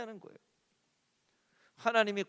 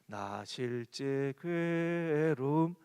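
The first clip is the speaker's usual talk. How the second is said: mock whiny child; 5 semitones lower, 4 dB quieter, much slower than usual. Child's voice acted in parable.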